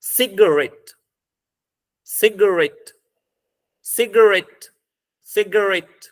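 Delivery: "सिगरेट" said alone, deliberately, with a falling end; syllable demo. Shown as a demonstration. Teacher having students count syllables, "सिगरेट" said deliberately. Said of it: "बोलिए".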